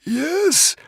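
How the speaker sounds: very high nervous little voice; tiny dragon, nervous; nervous squeak. A narrator's reading. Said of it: "replied the Woden's Fang nervously".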